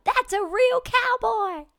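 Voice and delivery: very high, loud, drawn-out squeal of words; mock gushing excitement. Singer thrilled at hat-tipping cowboys, mock starstruck squeal. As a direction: play up being starstruck, slow and high.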